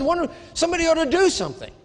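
fast, high, loud indignant cry of "somebody ought to do something"; retold outrage, pitch way up; outraged. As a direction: blurt it in self-righteous anger. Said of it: "I was outraged".

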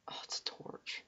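whispered relief. Real, not acted. Gamer spotting a torch, relieved whisper. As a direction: breathe it out under your breath. Quietly checking torch or lava.

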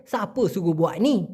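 colloquial rural Malay, "suruh" becomes "suguh"; village Malay accent. Shown as a demonstration. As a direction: village Malay with "Siapa" shortened to "sape". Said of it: "সেটাই ঘুরে হয়ে গেছে".

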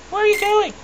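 very high, squeaky put-on voice; Donald. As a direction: squawk it like a cartoon character.

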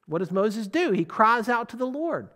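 usual speaking voice

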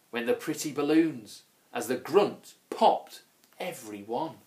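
usual speaking voice

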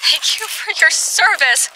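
high, slow mock-solemn farewell; mock salute. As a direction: salute the lizard in a funny put-on voice.

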